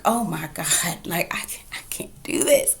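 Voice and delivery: stunned, hushed whisper; shocked whisper. Retold or imitated. Viewer reacting to the slur on screen, in a stunned whisper. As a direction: breathe it out in disbelief.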